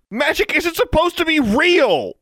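very high, loud, drawn-out protest; shouting. A narrator's reading. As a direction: shout it in angry disbelief. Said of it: "he shouted".

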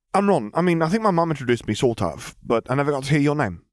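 put-on high, quick eager boy voice; excited young boy tumbling over words. A narrator's reading. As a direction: rush to make a friend. Ron voiced.

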